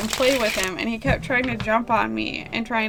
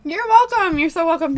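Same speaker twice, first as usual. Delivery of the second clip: excited; 7 semitones higher, 7 dB louder than usual. Real, not acted. Gushing thanks after hiccups.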